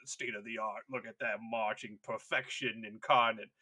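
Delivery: plummy non-rhotic admiring voice; mock pompous admirer. Admirer watching immaculate Ultramarines march, pompously proud. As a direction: intone it like a proud parade commentator.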